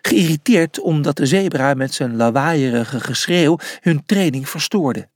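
plain reading voice

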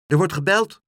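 put-on high, loud little-girl announcement; excited high-pitched shout; child calling out. A narrator's reading. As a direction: call out urgently about the door. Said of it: "riep Aagje".

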